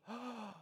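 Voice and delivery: quiet, shocked intake of breath; retold gasp. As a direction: imitate a child's hushed gasp. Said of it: "She gasped and goes".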